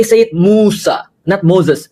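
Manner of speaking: slow, bossy pretend order; mock demanding. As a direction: drag out the demand to mock the pedant. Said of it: "Gimana kalau kita kata".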